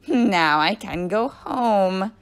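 loud, drawn-out gloating delight; very happy. A narrator's reading. Mean teacher rejoicing at leaving, very happy. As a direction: savour each word slowly and loudly. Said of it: "Missus Gorf was very happy".

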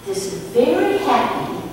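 normal talking voice

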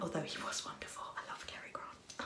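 soft whispered aside. Real, not acted. Fan whispering her love for Cary Grant, whisper. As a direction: breathe it as a fond secret.

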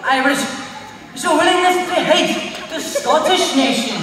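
lilting Irish accent. Shown as a demonstration. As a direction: play it as a proud Irishman. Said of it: "wanna go from Luffy to Irish".

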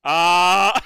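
usual speaking voice

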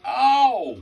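loud high ouch; sudden sharp yelp of pain; real pained yelp. Real, not acted. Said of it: "I ripped my skin off".

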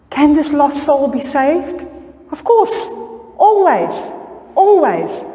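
rising high, ringing "Always"; emphatic assurance. Real, not acted. Preacher assuring the congregation a lost soul can be saved, high and emphatic. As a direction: reassure the church with a lifted cry.

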